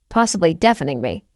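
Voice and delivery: plain reading voice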